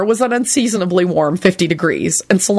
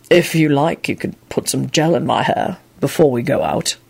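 lightly voiced man, a bit lower and unsteady; a touch of nerves in a lower voice; unsteady Quentin; 4 semitones lower than usual. A narrator's reading. Quentin to Solange, lower and a little unsteady. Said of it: "he responded unsteadily".

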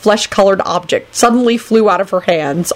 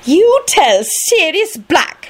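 high, slow, drawn-out disdain; haughty; 11 semitones higher, much slower than usual. A narrator's reading. Minerva sending a retort to Sirius, haughty. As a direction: stretch each word with lofty scorn. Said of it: "she replied haughtily".

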